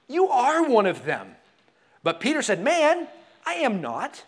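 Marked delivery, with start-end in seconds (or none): 0.1-1.4 slow, loud, higher-pitched accusation; single him out, loudly and slowly; emphatic accusation. Acted. Bystander accuses Peter in reading. 2.6-4.2 high, loud burst, then falling; emphatic denial. Acted. Peter denies the accusation.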